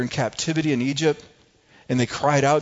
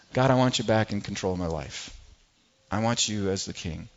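lower, softer heartfelt prayer; sincere prayer; 5 semitones lower, 4 dB quieter than usual. Retold or imitated. Kids at camp praying to God, low and soft. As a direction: hand over control quietly, from the heart. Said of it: "what they're saying essentially is this".

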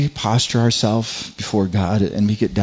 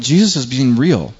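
ordinary talk

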